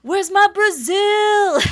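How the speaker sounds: high, drawn-out holler; imitated scream. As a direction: scream it out like you're calling across a crowd. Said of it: "he just starts screaming".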